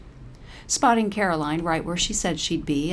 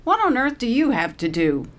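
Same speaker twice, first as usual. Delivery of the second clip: loud, higher sharp demand; barking; 7 semitones higher, 5 dB louder than usual. A narrator's reading. Paralee barks at departing Caroline, sharp and loud. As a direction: bark the question at her back. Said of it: "Paralee barks".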